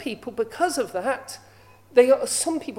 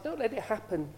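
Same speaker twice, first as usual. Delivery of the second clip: quiet, cold and hard, softer voice; cold-hearted; 3 semitones lower, 7 dB quieter than usual. Retold or imitated. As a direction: say it coldly, without feeling. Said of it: "the other lady was cold-hearted".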